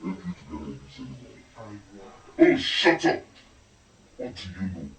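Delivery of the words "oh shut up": high, loud dismissive snap; irritated retort; snapping shut up. Acted. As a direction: cut them off sharply. Snapping at a teasing remark.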